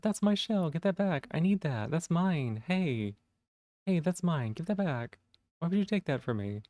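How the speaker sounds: high, petulant protest; a high pouty game-character whine; whiny game voice. Acted. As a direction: whine like a robbed little creature.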